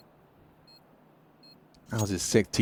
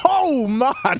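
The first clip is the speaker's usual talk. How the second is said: sudden high "oh" with a laugh; surprised laughing exclamation; 12 semitones higher than usual. Real, not acted. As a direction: caught off guard by the play. Reacting to a shocking kill.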